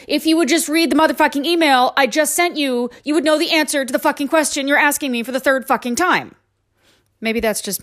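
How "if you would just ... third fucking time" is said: high, exasperated email rant; all-caps rant. Acted. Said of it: "write back in all caps".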